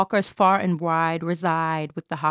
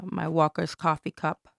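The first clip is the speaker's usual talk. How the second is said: ordinary delivery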